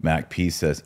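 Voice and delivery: ordinary delivery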